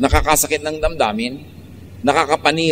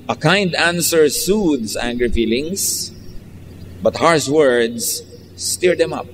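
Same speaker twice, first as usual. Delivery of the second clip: slow, measured reading of a verse; scripture reading; much slower than usual. Acted. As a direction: read the verse slowly and solemnly. (mabagal at taimtim na pagbasa ng talata)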